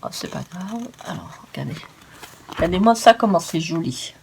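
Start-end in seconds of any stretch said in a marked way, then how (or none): 0.0-1.8 whispered, almost silent; hushed whisper to the viewers. Real, not acted. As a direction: drop to a breathy whisper to draw them in. Sudden hushed aside.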